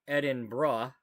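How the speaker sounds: Edinburgh as "ED-in-bruh", no g at the end; preferred pronunciation. Shown as a demonstration. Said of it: "You're trying not to say the G H".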